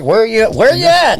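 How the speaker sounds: high, loud squawk of a caller; mock radio call. Dispatch calling the officer on the busy highway, high and loud.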